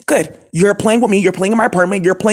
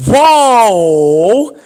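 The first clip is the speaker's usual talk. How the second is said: loud whoa; 5 dB louder than usual. Real, not acted. Reacting to news.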